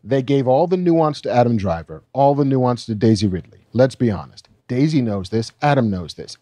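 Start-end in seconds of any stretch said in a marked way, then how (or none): 0.0-3.7 the line again, unhurried and deliberate; slower retake. Shown as a demonstration. Reading John Boyega's quote again, slower at the co-host's request. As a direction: take it slow and let each phrase sit.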